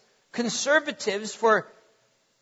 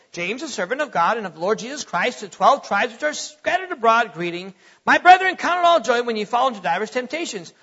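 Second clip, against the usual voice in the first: hurried, run-on recitation; rushed Bible reading; 3 dB louder, faster than usual. Shown as a demonstration. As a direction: race through the verse without pausing. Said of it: "we just read. We just say".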